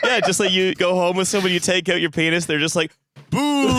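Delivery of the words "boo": sharp high mock boo. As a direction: boo him off the stage. Partner boos at exposed penis.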